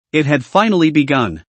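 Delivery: ordinary delivery, nothing marked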